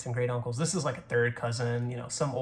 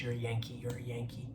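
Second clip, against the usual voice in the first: softer, trailing into a whisper; muttered taunt; 9 dB quieter than usual. Retold or imitated. Old cousin telling a relative they've changed, muttered taunt. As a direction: imitate a relative's accusing mutter. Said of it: "he was like".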